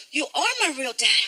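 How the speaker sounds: put-on slow, soft and earnest child voice; tender kid. A boy telling his stepdad who his real dad is, soft and slow. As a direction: say it simply, from the heart. Touching moment with Frankie.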